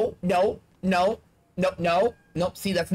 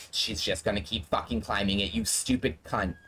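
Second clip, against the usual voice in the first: irritated mutter; 10 semitones lower, 5 dB quieter than usual. Real, not acted. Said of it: "I'm so irritated right now".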